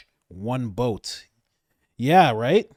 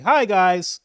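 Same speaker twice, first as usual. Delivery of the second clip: lightly voiced cheery politician, louder and higher; a hint of a salesman president greeting a crowd; mock JD Vance; 5 semitones higher, 7 dB louder than usual. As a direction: JD Vance introducing himself to a Russian crowd, breezy. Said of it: "being like".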